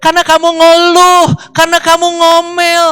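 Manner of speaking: loud, high-pitched rebuke; God scolding. Retold or imitated. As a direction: shout the reprimand, stretching the last phrases. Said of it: "Tuhan cuma ngomong di dalam firman-Nya". (teguran Tuhan dengan suara lantang)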